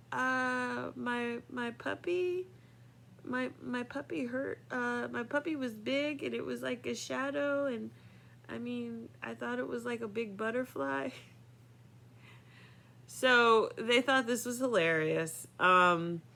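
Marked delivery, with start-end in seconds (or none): 0.1-11.1 put-on high, small, soft mumbling child voice; embarrassed little kid. Eleven-year-old embarrassed to tell the family about the dog, high and soft. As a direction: sheepishly confess like a shy eleven-year-old. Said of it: "I'm embarrassed. I'm eleven years old".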